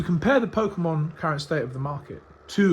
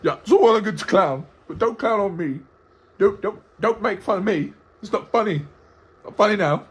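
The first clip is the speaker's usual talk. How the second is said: higher-pitched parody of a touchy guest; mock wounded complaint; 6 semitones higher, 3 dB louder than usual. Host apes the guest objecting to being clowned, mock hurt. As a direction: whinge in mock offence, pitched up.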